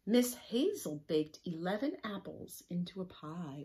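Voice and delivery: loud storybook reading; reading to children. A narrator's reading. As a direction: read it out to the class.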